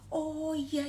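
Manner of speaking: ordinary delivery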